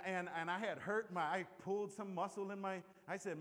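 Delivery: ordinary delivery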